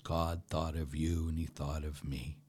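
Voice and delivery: usual speaking voice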